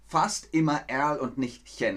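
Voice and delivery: normal talking voice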